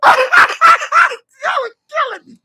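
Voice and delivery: high laughter running into the words; helpless, wheezy laughing; cracking up. Real, not acted. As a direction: squeeze the words out through a laughing fit. Host cracking up at chat jokes.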